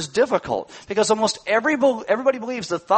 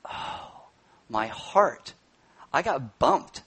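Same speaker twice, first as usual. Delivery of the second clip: hushed aside; 4 semitones lower, 6 dB quieter, much slower than usual. Retold or imitated. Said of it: "I went".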